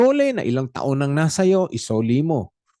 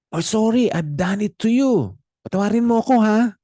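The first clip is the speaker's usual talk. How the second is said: breezy, higher, expectant sorry; demanding apology; 8 semitones higher, 3 dB louder than usual. Shown as a demonstration. Wrong way to apologize to someone you hurt, pushy and offhand. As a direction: toss off the sorry like it's owed. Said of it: "You're demanding forgiveness".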